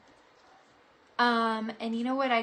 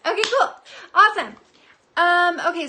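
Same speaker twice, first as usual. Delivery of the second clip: much louder, higher cheer; burst of excitement; 6 semitones higher, 13 dB louder than usual. Real, not acted. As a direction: pop up with enthusiasm. Sudden loud enthusiasm.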